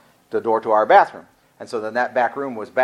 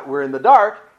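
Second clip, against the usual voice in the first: normal talking voice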